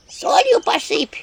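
high slow fretting imp cry; anxious imps. Acted. Worried imps urging her to add salt. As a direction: fret over the salt. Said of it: "переживали бесы".

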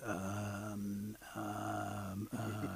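low, soft drawn-out ums; can't get any words out; shy suitor stuck on his hesitations; tongue-tied. Acted. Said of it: "He stands like this".

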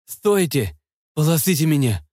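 usual speaking voice

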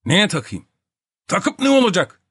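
high, protesting questions; displeased grumbling. A narrator's reading. Topak resisting the napkin, displeased grumbling. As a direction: object peevishly to a pointless order. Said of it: "diye söylendi Topak, hiç memnun olmamış bir sesle".

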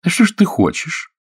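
high, ironic woman's voice. A narrator's reading. Actress questions Oleg about his ambitions, ironic. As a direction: challenge him with pointed irony. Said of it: "Голос актрисы прозвучал весьма иронично".

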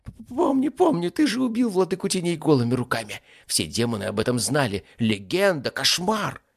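high, loud, stammering agreement; stammer, then gush in high-pitched fear; terrified demon. Acted. Strangled ifrit recalls his master's kill, terrified.

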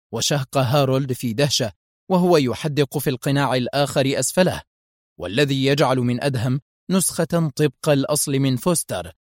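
ordinary delivery, nothing marked

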